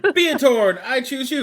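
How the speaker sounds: Pokemon trainer call. Pretend.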